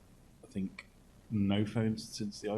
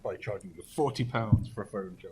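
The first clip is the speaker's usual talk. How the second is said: sarcastic; 2 semitones higher, 8 dB louder than usual. Pretend. Mocking Apple's charger excuse.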